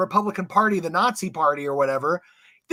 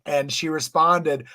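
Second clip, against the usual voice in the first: normal talking voice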